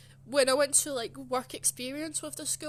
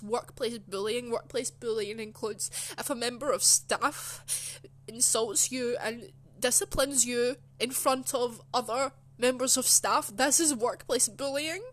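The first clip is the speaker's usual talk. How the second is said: slow reading, growing louder to the end; emphatic reading aloud; 6 dB louder than usual. Retold or imitated. Worker reading workplace letter as proof, emphatic reading. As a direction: read it out as proof, building in force.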